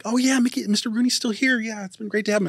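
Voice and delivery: lightly voiced nice lady on the phone; very nice woman. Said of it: "this very nice woman at the".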